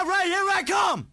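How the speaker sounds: shouted rowdy challenge; belligerent drunk. Acted. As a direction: yell it ready to rumble. Neighbors daring each other to fight.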